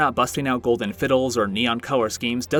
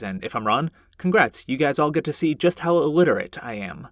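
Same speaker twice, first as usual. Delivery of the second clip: ordinary talk, nothing marked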